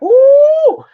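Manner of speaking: high, loud excited ooh; delighted squeal. Retold or imitated. Comic hearing the decision maker greet him, delighted squeal. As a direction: squeal with glee.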